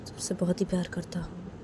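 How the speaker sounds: father whispers love to his crying daughter; tender whisper. Acted. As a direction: whisper it close and unvoiced.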